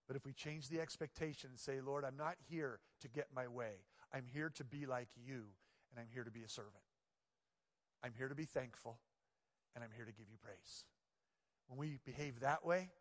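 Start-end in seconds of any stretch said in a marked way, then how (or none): 1.8-10.8 much softer, low, devoted prayer; humble prayer. Pretend. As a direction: pray it quietly, giving yourself up.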